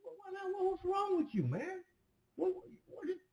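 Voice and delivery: can't believe his friend's nerve; exasperated disbelief. Retold or imitated. Answering the friend who says no way, high exasperated disbelief. Retold own exasperated reply.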